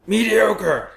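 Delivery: high, loud, barked verdict of mediocre; Immortan Joe. Retold or imitated. Co-host prompts an impression of the warlord, loud high barking. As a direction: bellow it like a warlord pronouncing judgment. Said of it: "you can do, like, you know, Immortan Joe".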